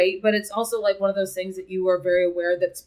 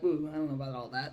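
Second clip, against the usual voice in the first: a wary, lower, softer ooh; uneasy, quieter hesitation; hesitant ooh; 6 semitones lower, 10 dB quieter, faster than usual. Retold or imitated. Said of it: "then I'm like".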